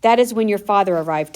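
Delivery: usual speaking voice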